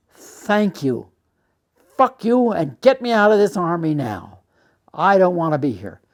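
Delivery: retold fury, punching out each phrase; angry outburst. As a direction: tell him off hard, loud and defiant. Acted angry outburst to lieutenant.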